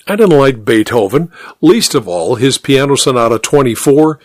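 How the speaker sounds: ordinary delivery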